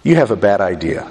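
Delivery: gentle correction. Retold or imitated. God gently telling Peter his idea is bad, gentle correction. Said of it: "God's way of very gently saying".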